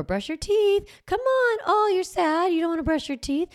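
mock-sweet parent pleading high; sugary coaxing parent. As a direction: coax a stubborn kid in a high, syrupy voice. Coaxing parent, acted nagging scene.

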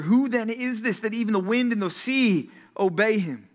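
high, amazed question; frightened disciples marvelling aloud; awed disciples. Acted. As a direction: ask it in stunned wonder. Disciples in awe.

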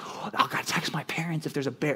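whispered worried camper; anxious whisper. Retold or imitated. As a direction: hush it like a nervous kid at camp.

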